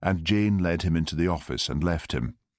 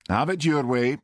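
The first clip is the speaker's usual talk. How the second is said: haughty; 8 semitones higher than usual. Acted. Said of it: "he said haughtily".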